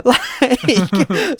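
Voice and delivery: breaking into laughter, high; laughing. Real, not acted. Cracking up with a co-host over the silly plot, laughing. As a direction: laugh through the word.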